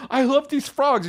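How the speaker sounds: put-on very high, overcome little-kid voice; Calvin, all emotional. Son overcome while picking up frogs, high emotional voice. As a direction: squeak it like a child about to cry. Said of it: "all emotional, because he was like".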